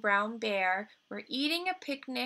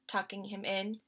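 plain reading voice

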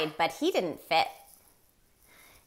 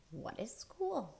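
puzzled moose; 5 semitones higher, 14 dB quieter than usual. Acted. Morris asks innocently.

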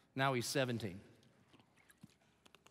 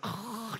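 scraping throat noise of sliding metal; short harsh sliding sound effect; imitated car skid; 6 semitones higher, 6 dB quieter than usual. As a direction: make the hiss of a car skidding. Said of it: "the car just goes".

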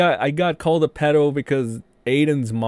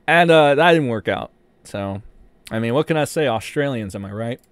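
usual speaking voice

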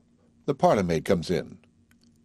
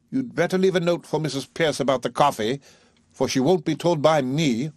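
put-on higher young woman, pointed; cheeky Eliza; 7 semitones higher than usual. A narrator's reading. Eliza telling Higgins about the coffee note, pointedly. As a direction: needle him with a last instruction.

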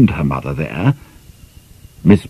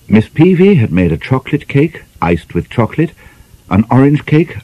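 plain reading voice

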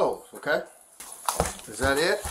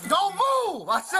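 angry shout; 6 semitones higher, slower than usual. Real, not acted. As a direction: shout the command. Officer restraining struggling woman.

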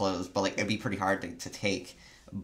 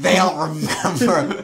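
laughing; 7 semitones higher, 8 dB louder, slower than usual. Real, not acted. Joking about forgetful show writers.

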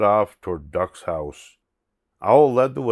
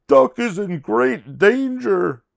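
high, loud cry of alarm; Owl's dramatic high-pitched warning; 13 semitones higher, 6 dB louder than usual. Acted. Owl warns of danger.

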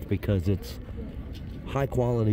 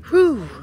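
hot exhale; 4 dB louder than usual. Real, not acted. Sweating in the heat.

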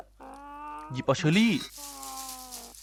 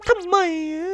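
much higher, squeaky cartoon whine; 4 dB louder than usual. Gamer echoes his question as a squeaky cartoon critter.

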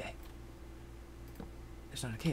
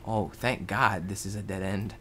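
normal talking voice

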